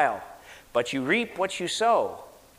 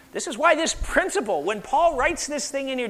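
normal talking voice

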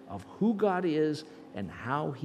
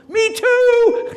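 a very high, loud joyful yell; shout out the window; 17 dB louder than usual. Retold or imitated. Staff member joining in out the window, a joyous shout. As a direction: whoop it out the window. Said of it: "he was rolling his one".

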